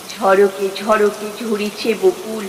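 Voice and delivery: ordinary talk, nothing marked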